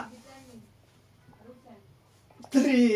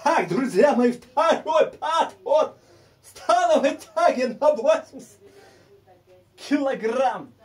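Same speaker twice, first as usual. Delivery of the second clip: higher, louder, stretched announcement; hammed-up announcer; 5 semitones higher, 4 dB louder than usual. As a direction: announce the set like a ring MC. Announces the set grandly. (торжественно, как ведущий)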